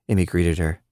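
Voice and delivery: ordinary delivery, nothing marked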